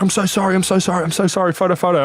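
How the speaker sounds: high, pleading apology; pleading fan. Retold or imitated. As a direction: beg sheepishly for a photo. Fan apologising, wanting photo.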